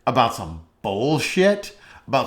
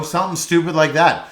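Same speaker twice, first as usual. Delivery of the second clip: ordinary talk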